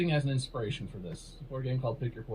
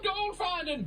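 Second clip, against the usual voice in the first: mock prospector. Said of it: "Or, "I'm".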